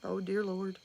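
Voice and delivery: soft, weary aside under the breath; quiet despair at the kids; exasperated mutter. Retold or imitated. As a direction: mom sighing to herself about the costume fight, quiet. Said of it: "And I'm like".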